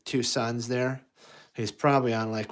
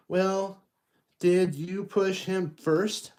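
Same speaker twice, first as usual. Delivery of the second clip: high, slow probing parent voice; questioning parent; 8 semitones higher, much slower than usual. Acted. A parent asking who pushed first, high slow. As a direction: ask like a suspicious parent.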